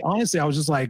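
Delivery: ordinary talk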